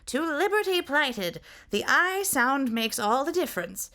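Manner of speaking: very high and thin, leaning on liberty; thin voice, stress liberty. A narrator's reading. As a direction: correct him, landing hard on liberty. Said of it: "the thin voice corrected".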